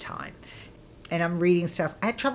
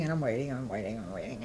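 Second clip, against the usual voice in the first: each repeat lower and softer, dying away; fading out; 7 semitones lower, 8 dB quieter, much faster than usual. Retold or imitated. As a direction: let the waiting trail off into nothing.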